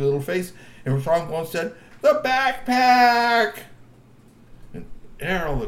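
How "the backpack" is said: slow, high, loud drawn-out shout; shocked cry. Acted. Strongbones sees his stolen backpack, slow shocked cry. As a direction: cry out in alarm.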